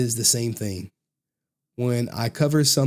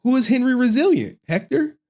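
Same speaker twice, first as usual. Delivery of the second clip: mock confused; 9 semitones higher than usual. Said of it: "They're like".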